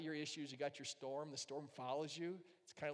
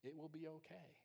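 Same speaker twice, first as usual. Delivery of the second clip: much softer, gentle reassurance; soft promise; 10 dB quieter than usual. Retold or imitated. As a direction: promise it tenderly, quietly. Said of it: "a promise he makes".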